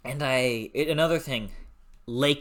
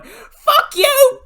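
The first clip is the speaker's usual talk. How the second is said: put-on shrieking high and loud like an angry child; mock scream, pitched way up; imitated screamed curse; 12 dB louder than usual. Imitating twelve-year-old Scottish girl cursing her kidnapper, screaming. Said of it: "screaming curses at you".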